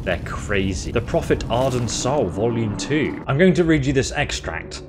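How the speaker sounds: usual speaking voice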